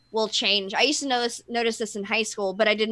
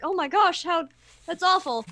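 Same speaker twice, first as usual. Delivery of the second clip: mock shock; 6 semitones higher than usual. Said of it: "anyone thinks it's like".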